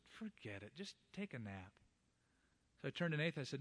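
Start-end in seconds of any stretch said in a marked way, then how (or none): 0.1-1.7 softly voiced wife, much quieter; wife gently telling her tired husband to nap, quietly; wife's soft advice. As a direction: hush it like a wife coaxing him to rest. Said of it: "and my wife said".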